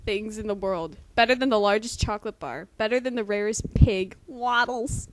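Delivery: loud, hammed-up fanfic reading; dramatic reading. Friend reading the fanfic aloud, loud mock-dramatic delivery. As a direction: keep up the overblown stage reading.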